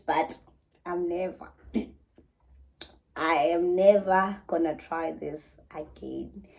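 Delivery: ordinary talk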